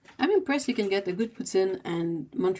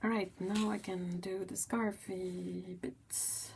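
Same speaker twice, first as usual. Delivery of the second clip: whispered aside; 9 dB quieter, slower than usual. Real, not acted.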